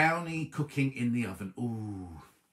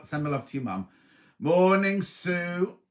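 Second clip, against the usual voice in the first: ordinary talk, nothing marked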